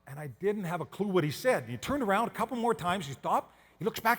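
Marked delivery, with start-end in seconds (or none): none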